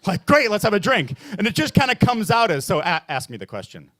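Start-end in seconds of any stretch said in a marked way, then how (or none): 0.2-1.1 louder, higher, upbeat brush-off; Irish-side voice. Acted. Comic's Irish side dodging how are you, louder and breezy. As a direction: cut the talk short with a loud cheer. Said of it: "the other side of me".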